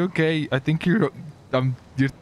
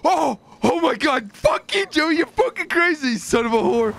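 outraged yell; 13 semitones higher than usual. Real, not acted. Gamer yelling at his friend who killed him, high outraged cursing. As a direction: blast it out in outrage.

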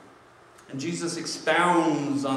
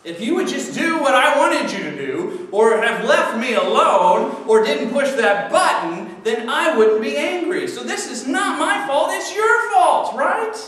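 mock indignant blame, building; 8 semitones higher, 4 dB louder than usual. Acted blame-shifting rant.

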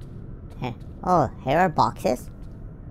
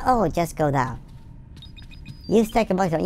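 ordinary delivery

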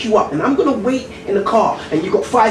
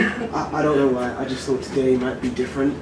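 quiet disappointment; 8 semitones lower, 6 dB quieter than usual. Acted.

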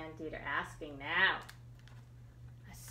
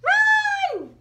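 shout; 17 dB louder than usual. Acted. Ants warn each other.

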